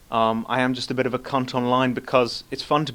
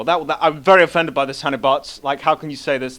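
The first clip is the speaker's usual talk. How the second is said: mock offended; 3 semitones higher, 8 dB louder than usual. Host answering a cancer jibe, mock offended. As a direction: play up being terribly hurt.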